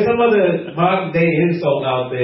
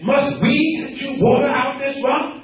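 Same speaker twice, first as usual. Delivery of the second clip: high, indignant rhetorical question; raised, lightly acted challenge; angry Moses; 8 semitones higher than usual. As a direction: act out an angry leader taking the credit. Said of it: "'cause he was so angry".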